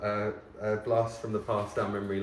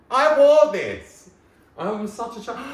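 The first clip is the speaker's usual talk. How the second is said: excited squeal; 15 dB louder, slower than usual. Real, not acted. Finding his old birthday trousers, squealing with delight. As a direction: squeal it like you can't believe you wore it.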